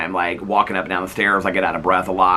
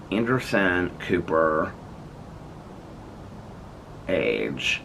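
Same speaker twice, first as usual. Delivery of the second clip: slow, drawn-out phone dictation; 3 semitones lower, 8 dB quieter, much slower than usual. Real, not acted. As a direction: spell it out for the phone.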